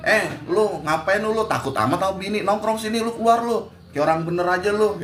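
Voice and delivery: high teasing buddy call; calling out to a friend. Retold or imitated. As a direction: egg a friend on to come hang out. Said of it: "woro-woro manggilin temen-temen".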